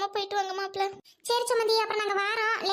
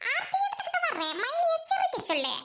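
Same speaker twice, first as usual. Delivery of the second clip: laughing; 9 semitones higher than usual. Real, not acted. Teasing sister in law, laughing.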